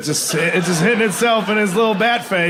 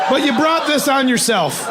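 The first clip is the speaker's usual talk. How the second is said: laughing; 7 semitones higher than usual. Real, not acted. Teasing laughing audience member.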